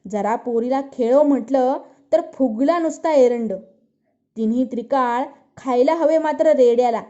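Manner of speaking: put-on mother berating her son over the crying baby, angrily; angry scolding. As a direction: scold the lazy boy, worn out and furious. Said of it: "दुःख संतापाने वेडी होऊन बोलत होती".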